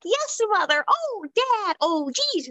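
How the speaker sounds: mock the perfect little child; mocking old scripts in a sweet high child voice; saccharine kid lines. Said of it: "the scripts were like".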